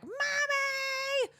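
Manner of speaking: high-pitched mock yell of a kid; child yelling. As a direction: holler for mom across the house. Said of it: "Clark and I just yell".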